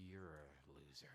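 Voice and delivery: put-on whispered teen taunt; mock whisper. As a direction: breathe it out like a snide aside. Imitating high school guys.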